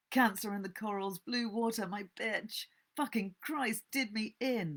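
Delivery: put-on a drunk man raving through a thin hotel wall, loud and high; ranting drunk. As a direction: rave at the wall like a drunk falling apart. Drunk man rants at night.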